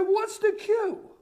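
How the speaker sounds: high, slow mock bewilderment; baffled question. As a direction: draw it out high, utterly baffled. Retells asking, comically baffled.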